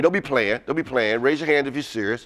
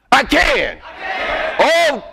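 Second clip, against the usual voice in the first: rallying shout; 9 semitones higher, much slower than usual. Shown as a demonstration. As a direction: shout it to lift the room. Speaker leads the chant again.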